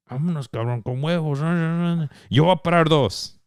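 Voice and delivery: put-on Spanish accent. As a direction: imitate a keeper from Spain. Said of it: "In that Spanish accent".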